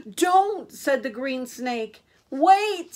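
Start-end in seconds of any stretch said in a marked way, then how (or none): 0.1-0.7 loud, high warning cry; urgent warning. A narrator's reading. Green snake warns the goats off the bridge, urgent cry. Animals all warn Little Gruff. 2.3-2.9 high, loud call to stop; urgent warning. A narrator's reading. Animals all warn Little Gruff.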